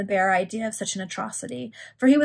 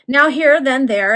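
emphatic; 5 semitones higher, 11 dB louder, slower than usual. Acted. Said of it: "lively retelling of wandering".

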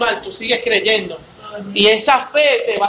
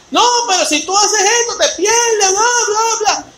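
high, whiny mock voice of the naysayers; mocking whiny critic; 10 semitones higher than usual. Preacher mocking critics who scold believers, high and whiny. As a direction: parody the scolding voice, pitched way up.

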